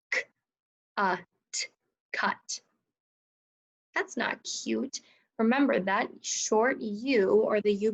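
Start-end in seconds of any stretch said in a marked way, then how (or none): 0.1-1.7 "K-, uh, t" as three separate sounds; tapped-out sounds. Shown as a demonstration. Teacher showing what is spelt so far, "K-, uh, t" tapped out. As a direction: give "uh" short, with gaps around it. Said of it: "Let's tap out the word".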